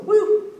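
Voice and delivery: high, loud "woo"; short high-pitched whoop; character's whoop. Acted. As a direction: let out a high cartoon whoop. Boozle's cry in pitched scene.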